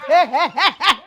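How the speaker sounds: laughing through the words. Real, not acted.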